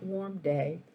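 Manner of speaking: plain reading voice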